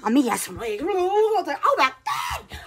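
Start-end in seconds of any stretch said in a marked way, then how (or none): 1.6-2.6 high, shouted oh my God; startled shout of oh my God; surprised gasp. Retold or imitated. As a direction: blurt it out in shock. Excited exclamation in story.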